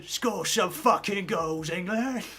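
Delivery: hammed-up English football fan accent. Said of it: "I read that in an English accent".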